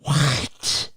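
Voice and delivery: stunned disbelief. Real, not acted. Stunned by the age fact.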